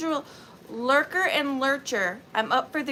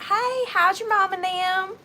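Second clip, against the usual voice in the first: loud, higher Southern greeting; thicker Southern accent; 5 semitones higher, 5 dB louder than usual. Acted. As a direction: holler hello like a small-town Southerner. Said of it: "they get more Southern".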